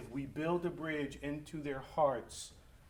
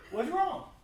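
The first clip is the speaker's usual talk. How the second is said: laughing mock dad; 12 semitones higher, 6 dB louder than usual. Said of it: "And he said".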